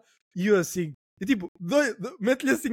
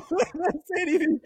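cracking up through "Bué da sério", slow and higher; laughing repeat; 8 semitones higher, much slower than usual. Real, not acted. Repeating it to his friend, laughing. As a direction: say it again, laughing at the memory. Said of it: "Oh, mas bué da sério".

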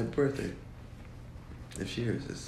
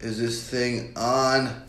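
louder, slow, stretched final word; drawn-out mic check; 3 semitones higher, 5 dB louder, much slower than usual. Shown as a demonstration. Guest playing at testing the mic, drawn-out mic check.

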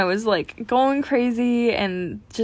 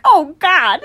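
mock dismay; 8 semitones higher, 9 dB louder than usual. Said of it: "I'm just like".

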